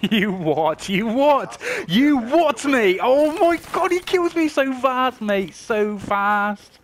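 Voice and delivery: high, loud furious shouting at the game; enraged yelling. Real, not acted. Gamer raging after being killed so fast, furious shout. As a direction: explode in disbelief, building to a yell.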